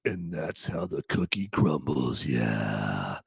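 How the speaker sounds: low, slow, quiet tough-guy voice; badass delivery. Shown as a demonstration. Showing how a badass says the movie line, low slow cool. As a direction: drop it deep and drag it out like a badass. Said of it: "that's how a badass says it".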